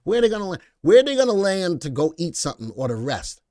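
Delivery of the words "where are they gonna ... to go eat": higher, louder clean retake; louder corrected repeat. Real, not acted. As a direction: say it again without the swear, with more push. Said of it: "I didn't mean to say that".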